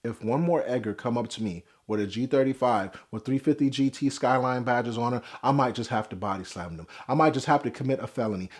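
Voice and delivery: dropped low, mock-serious threat; quiet mock threat. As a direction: threaten under your breath.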